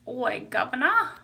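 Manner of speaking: playful mock Cockney; Cockney accent. Shown as a demonstration. Streamer showing off an accent, mock Cockney. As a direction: put on a stock Cockney greeting accent. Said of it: "That's the Cockney accent".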